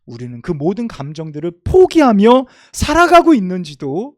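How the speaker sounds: fervent sermon delivery; preaching. Acted. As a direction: preach it with rising fervor. Preacher building to climax.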